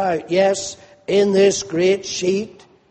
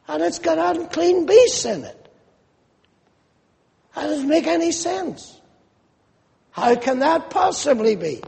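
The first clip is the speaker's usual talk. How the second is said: incredulous Peter; 8 semitones higher than usual. Acted. Voicing Peter's baffled thoughts.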